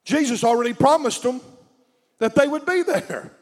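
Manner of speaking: fiery preaching at full force. Acted. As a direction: preach it loud and rising.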